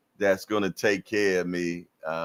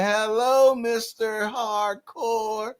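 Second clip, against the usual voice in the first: put-on much higher sweet child voice, slow on Mister Hardcore; little girl's greeting; 13 semitones higher, slower than usual. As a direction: imitate a small girl chirping hello. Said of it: "She said".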